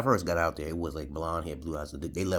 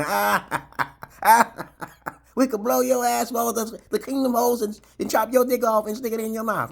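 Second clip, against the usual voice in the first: laughing mock threat; 9 dB louder than usual. Drunk gunmen laugh and threaten him, high cackling mock voice. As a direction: gloat and threaten through a laugh.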